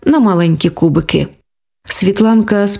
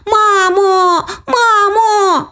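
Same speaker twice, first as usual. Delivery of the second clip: high crying call; girl crying for mum; 12 semitones higher, much slower than usual. Acted. Girl calling her mother, high crying child voice. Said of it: "закричала Оленка".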